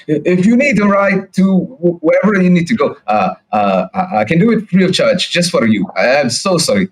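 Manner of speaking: high, eager-to-please voice; lightly voiced anxious driver; apologetic NPC. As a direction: fawn over them to make amends. NPC offers a free ride.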